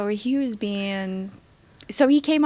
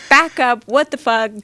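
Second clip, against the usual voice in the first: loud, high retold shout; retelling a partner yelling at a man; angry yelling; 5 semitones higher, 11 dB louder than usual. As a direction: bark it at him to back off. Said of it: "She's like".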